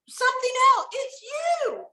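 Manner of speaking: high, loud exclamation; emphatic shout. Real, not acted. Telling artists their business is them, loud high shout. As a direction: hammer the point home to the audience.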